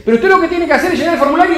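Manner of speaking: high, loud, rapid explanation; insistent bank teller. Acted. Cashier answering a daily complainer, exasperated insistence. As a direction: explain the procedure urgently over a complainer.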